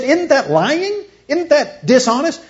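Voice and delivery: high, slow, drawn-out questions; mock scandalized challenge. Duped friend challenges the cow painter, mock scandalized. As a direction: stretch each question in acted disbelief.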